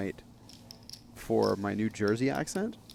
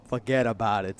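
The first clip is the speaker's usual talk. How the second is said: put-on New York accent; 6 dB louder than usual. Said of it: "says Johnny Drama".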